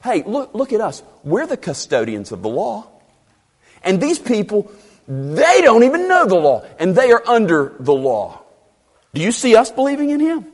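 high-pitched mock self-importance; hammed-up scornful arrogance; pompous Pharisee. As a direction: boast as the law's haughty custodian. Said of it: "This is embedded in their statement".

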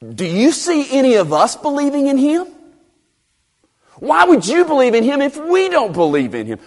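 high-pitched, pompous mock indignation; hammed-up Pharisee arrogance; dripping with ego. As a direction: sneer as a self-important religious leader. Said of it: "this just drips with ego".

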